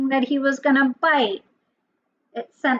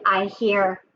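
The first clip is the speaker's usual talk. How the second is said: ordinary delivery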